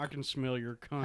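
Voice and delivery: soft Jodie Foster voice, out of the mouth corner. Shown as a demonstration. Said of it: "talk out of the side of your mouth".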